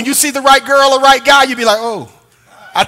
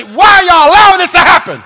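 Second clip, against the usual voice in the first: louder, higher exasperated fussing at the coaches; mock fussing; 5 semitones higher, 4 dB louder than usual. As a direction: ham up a fan yelling at the TV. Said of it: "I call them and I say".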